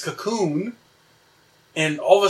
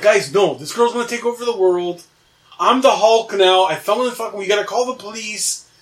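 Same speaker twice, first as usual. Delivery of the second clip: higher, agitated pleading; retold urgent plea; 5 semitones higher, 5 dB louder than usual. Dreamer begging roommates to call the police, urgent. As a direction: insist on it, voice climbing and getting louder.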